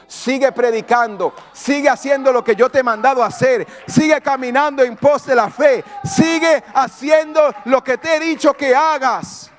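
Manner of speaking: preacher's exhortation climbing to a shout; rousing command. Retold or imitated. God ordering the servant to keep going, rousing shout. God's command voiced, building at climax.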